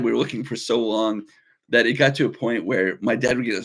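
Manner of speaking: ordinary talk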